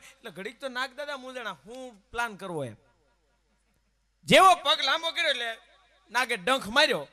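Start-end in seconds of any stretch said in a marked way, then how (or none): 4.2-7.1 loud punchy storyteller build-up; dramatic loud telling. Acted. Storyteller booming the snake's strike. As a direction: punch it out like the big moment.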